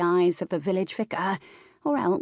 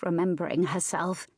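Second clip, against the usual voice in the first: plain reading voice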